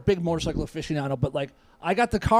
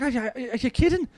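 higher-pitched incredulous bartender; hard-ass Irish bartender; 5 semitones higher than usual. Retold or imitated. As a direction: imitate a tough Irish barman in disbelief. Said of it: "real hard-ass look, looking Irish bartender".

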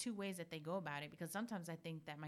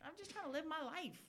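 much higher, loud mock teenager; mock kid; 5 semitones higher, 5 dB louder than usual. Playing the kids answering mom's warnings, high mock whine. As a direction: ham up a kid dismissing mom. Playing her kids' view.

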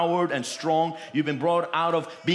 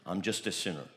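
meek self-pity; 9 semitones lower, 8 dB quieter than usual. Retold or imitated. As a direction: lower and softer mock mumble.

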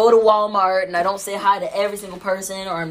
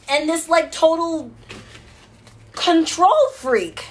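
excited emphasis; 10 semitones higher, 4 dB louder than usual. Real, not acted. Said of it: "I have this alter ego".

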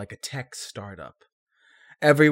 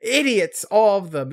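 higher, louder mock outrage; mock anger; 6 semitones higher, 4 dB louder than usual. Joining in the imitation of Solus, mock anger. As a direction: play up the exasperated contempt. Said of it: "he's just so angry about".

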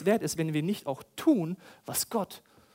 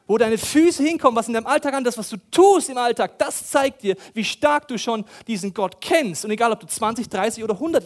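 loud, high, emphatic sermon delivery; forceful preaching; 5 semitones higher, 9 dB louder than usual. Real, not acted. Preacher confronting the congregation, loud and forceful. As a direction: drive the point home hard.

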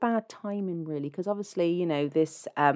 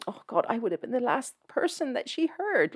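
lightly voiced self-centred radio presenter, higher and climbing; mock Sasha; 7 semitones higher, 5 dB quieter than usual. Radio presenter remarking on the dead listener, mock self-centred voice. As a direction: imitate a shallow character's comment, higher and softer. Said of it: "Sasha actually comments on that".

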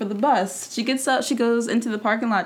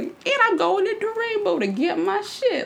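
high matter-of-fact quote; 10 semitones higher than usual. Retold or imitated. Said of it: "she's like".

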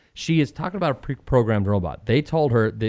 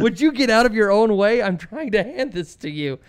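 Mister Garrison; 10 semitones higher than usual. Retold or imitated. Host retells South Park Trump pleading, high cartoon voice. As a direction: play the cartoon teacher pleading, pitched way up. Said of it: "he looks at her and goes".

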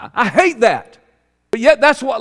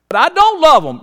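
higher, louder objection; protesting; 9 semitones higher, 7 dB louder than usual. Acted. A churchgoer talking back to God, protesting. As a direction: push back in loud, raised protest.

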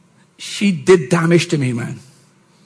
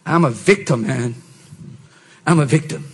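softer, lower, mock self-pitying murmur; 6 semitones lower, 6 dB quieter than usual. Pastor mocking the patient, soft self-pity. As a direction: play the pitiful victim. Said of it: "I just knew it".

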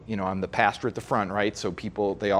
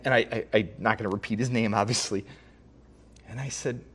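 usual speaking voice

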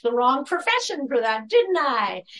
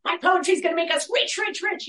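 mock giddy greed; 7 semitones higher than usual. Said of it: "And I was like".

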